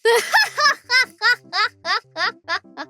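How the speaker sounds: loud high laughing fit; cracking up out loud; burst of laughter. Real, not acted. Laughing at the word BB.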